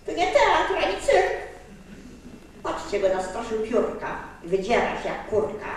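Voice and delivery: usual speaking voice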